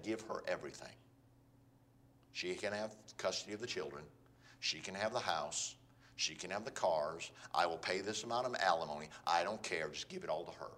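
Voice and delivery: low, soft, defeated surrender; devastated resignation. Acted. Devastated spouse giving up everything in divorce, resigned. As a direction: give up quietly, all the fight gone. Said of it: "they are so devastated".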